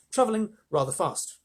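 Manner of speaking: ordinary delivery, nothing marked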